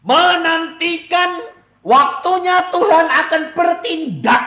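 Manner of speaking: slow, loud, high-pitched sermon shout; fiery preaching. Acted. Preacher to congregation, loud fiery preaching. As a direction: thunder it from the pulpit.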